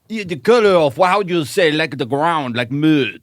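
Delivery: a Frenchman groping for English words; French accent. Pretend. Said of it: "Why do we keep going French?".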